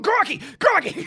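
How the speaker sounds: high-pitched Aussie holler; re-enacted high yell. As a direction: squawk it out at a stranger. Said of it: "I was just fucking yelling crikey".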